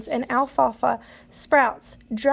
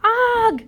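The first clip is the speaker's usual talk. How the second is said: startled grunt; 10 semitones higher, 6 dB louder than usual. Acted. Startled turkey bolts from nest.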